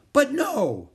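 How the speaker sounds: high, loud protest. A narrator's reading. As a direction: object in disbelief. Gaston protesting.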